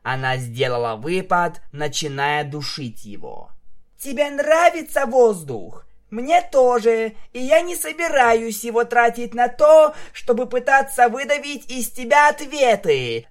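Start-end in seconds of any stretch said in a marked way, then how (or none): 4.0-13.3 high, loud raging shout; furious yelling. A narrator's reading. Vinyl chokes the captive for answers, screaming. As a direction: scream it while throttling the captive. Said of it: "закричала она".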